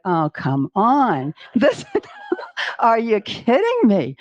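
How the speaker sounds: slow groan breaking into a laugh; laughing disbelief. Retold or imitated. Reacting to the second postcard, laughing.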